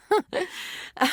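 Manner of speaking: short high chuckle; light laugh. Acted. Amused reaction in acted dialogue.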